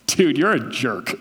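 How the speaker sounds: laughing, playful jab; joking insult with a chuckle; teasing. Shown as a demonstration. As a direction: mess with a friend, laughing. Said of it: "The first way, I'm messing with you, we're having fun".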